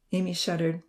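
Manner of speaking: ordinary delivery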